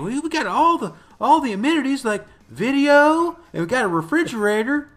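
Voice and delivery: high-pitched, loud show-off impression; exaggerated imitation of a proud host; mock boasting host. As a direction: crow the list in a high, hammed-up voice. Imitating man bragging about his amenities.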